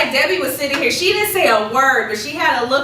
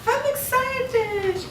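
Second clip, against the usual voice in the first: "I'm excited" drawn out slowly and limply; flat unexcited; 13 semitones higher, 4 dB quieter, much slower than usual. Shown as a demonstration. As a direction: say it like you don't mean it. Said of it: "Have you ever heard someone say".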